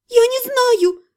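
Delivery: high shriek, pitched well up. Acted. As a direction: squeal it in panic. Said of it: "взвизгнула я". (взвизгнула высоко)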